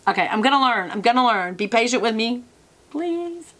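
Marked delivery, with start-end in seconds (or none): 2.9-3.5 high, soft little plea; playful pleading. Pretend. Vlogger begging viewers to be patient, playful pleading. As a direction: beg sweetly, pitched up and small.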